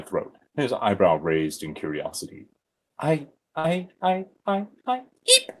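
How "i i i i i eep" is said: high stammer ending in a very high eep; squeaked stammer. Acted. Flustered narrator squeaking at the handsome man. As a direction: stutter then squeak like a startled mouse. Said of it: "I squeaked out".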